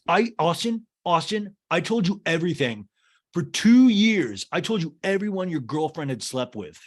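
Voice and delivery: a hammed-up outraged friend; mock indignant [name]. [name] insisting he told Austin everything, mock exasperated.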